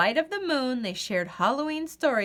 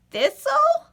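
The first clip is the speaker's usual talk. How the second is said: much higher, louder surprised question; surprised recognition; 7 dB louder than usual. Acted. Goose recognizes Thistle, high and surprised. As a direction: gasp the name. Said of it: "Goose honked".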